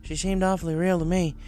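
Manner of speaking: tomboy pony; Rainbow voiced. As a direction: recount the horror.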